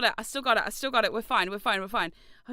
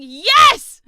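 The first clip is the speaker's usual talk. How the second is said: high, loud yell; exasperated yes; 11 semitones higher, 19 dB louder than usual. Retold or imitated. Retelling yelling yes at wife about brushing. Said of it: "I'm like".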